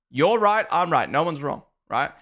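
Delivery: ordinary delivery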